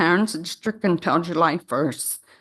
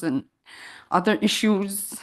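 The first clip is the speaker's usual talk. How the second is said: ordinary talk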